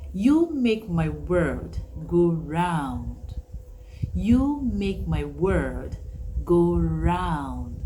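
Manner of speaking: slow, each word given weight; slow tender delivery; slow heartfelt. Shown as a demonstration. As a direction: draw "You make my world go round" out slowly. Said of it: "Another one is".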